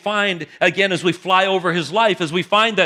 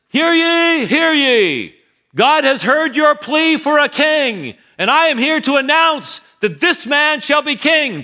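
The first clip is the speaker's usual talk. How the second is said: much higher, louder herald's call; royal proclamation; 8 semitones higher, 5 dB louder, slower than usual. Acted. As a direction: announce it to the whole nation like a town crier. Samuel proclaiming to gathered nation.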